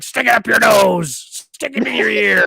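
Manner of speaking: slow, high, loud wrestler yell; mock yell. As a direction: bellow it like a ham wrestler. Quoting Piper's silly insults.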